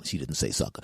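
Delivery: ordinary delivery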